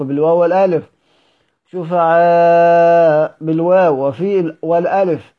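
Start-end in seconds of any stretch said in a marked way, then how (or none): 1.7-3.3 very slow, louder drawn-out chant; recited word. Shown as a demonstration. Teacher chanting a Quran word to students, slow and loud. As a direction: hold the vowels like a reciter.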